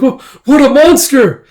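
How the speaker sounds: high, loud stammered horror; acted shock at a monster; horrified cry.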